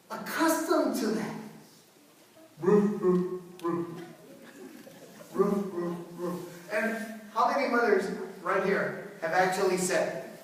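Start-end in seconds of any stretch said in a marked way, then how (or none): 2.6-3.9 short, low woofs with gaps; imitated barking dog. Neighbor's dog going all night, imitated barking. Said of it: "It's like that dog". 5.3-6.4 short, low woofs with gaps; imitated barking dog. Neighbor's dog going all night, imitated barking. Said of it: "It's like that dog".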